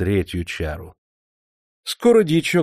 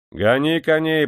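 higher, louder command to the driver; barked order; 7 semitones higher, 5 dB louder than usual. A narrator's reading. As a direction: shout at the coachman. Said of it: "прикрикнул поручик". (окрик: гони коней)

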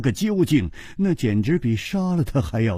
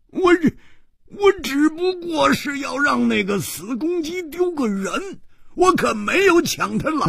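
stammering cry, voice jumping much higher; panicked yell; 12 semitones higher than usual. A narrator's reading. Hu Tiehua denying he kidnapped her, panicked yell. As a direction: jump up and shout your defence. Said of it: "胡铁花跳起来大叫着说".

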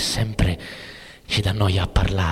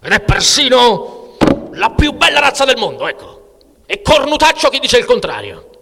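much higher, louder rant; Artur shouting; 14 semitones higher, 6 dB louder than usual. Acted. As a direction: yell it at your friend. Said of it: "parte a gridarmi addosso". (grida addosso all'amico, molto acuto e forte)

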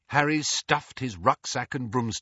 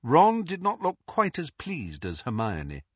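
ordinary delivery

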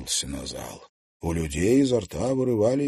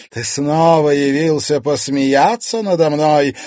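loud, high, spiteful rant; angry, ringing accusation; furious tirade; 9 semitones higher, 11 dB louder than usual. A narrator's reading. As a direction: berate a husband with building defiance. Vengeful spirit berates her husband.

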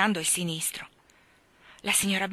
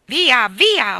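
loud, higher angry brush-off; yelling; 5 semitones higher, 13 dB louder than usual. A narrator's reading. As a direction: shoo him away. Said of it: "urlò il signor Banks". (urlato, scacciandolo)

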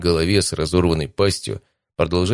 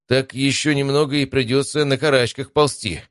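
mild grumble, pitched up; understated, higher-pitched complaint; complaining; 7 semitones higher than usual. A narrator's reading. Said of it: "пожаловался Матвей".

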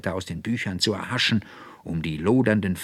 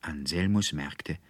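usual speaking voice

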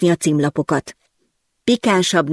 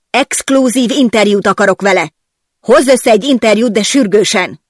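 higher, louder urgent orders; demanding shout; 4 semitones higher, 4 dB louder than usual. Acted. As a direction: bark out the demand. Boss demands interview. (a főnök követelőzve kiabál)

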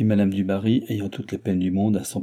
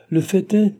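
pointed insinuation; 10 semitones higher, 5 dB louder than usual. A narrator's reading. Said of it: "avec une intention impossible à rendre".

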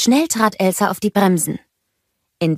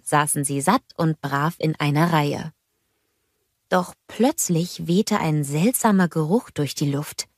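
ordinary delivery, nothing marked

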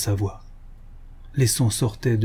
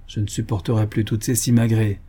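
ordinary delivery, nothing marked

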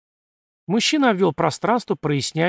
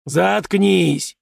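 stern; 4 semitones higher, 4 dB louder than usual. A narrator's reading. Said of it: "сурово потребовал Фома".

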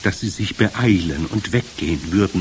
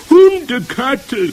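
long, loud, high witch shriek; screech; 9 dB louder, slower than usual. A narrator's reading. Whole coven takes up the smell alarm, screeching. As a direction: screech it syllable by syllable. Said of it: "kreischten sie".

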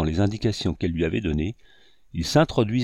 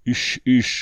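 ordinary delivery, nothing marked

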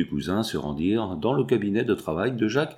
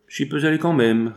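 higher-pitched, mildly cynical comeback; cynical retort; 6 semitones higher than usual. A narrator's reading. Former foreman defies the blackmailing cousin, mildly cynical. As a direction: toss it back with a touch of cynicism. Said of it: "répliqua d'un ton cynique l'ex-contremaître".

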